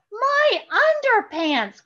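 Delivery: high, loud, slow cry of dismay. A narrator's reading. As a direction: wail it out high and slow for lost pants. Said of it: "cried Charlie".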